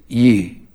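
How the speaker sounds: Ye as the Southern singular you, lips not pushed out. Shown as a demonstration. Nashville native teaching one-person Ye, Southern pronunciation. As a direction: say Ye low, with lips relaxed. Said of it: "Here's how you say it".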